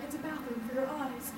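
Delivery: softly voiced mock model; a hushed, lightly put-on commercial model delivery; Japanese model. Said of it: "like, a Japanese model".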